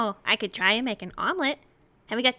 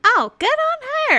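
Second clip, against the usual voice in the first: put-on 1 boyish delight; 5 dB louder, slower than usual. Rye cheering his mum.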